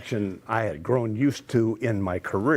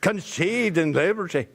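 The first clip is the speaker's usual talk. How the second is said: higher, louder Scottish brogue; booming Scottish Highland orator; Scottish accent; 8 semitones higher, 3 dB louder than usual. Acted. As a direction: Gettysburg Address in a Scottish accent, Jamie Fraser style. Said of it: "like Jimmy Fraser on Outlander".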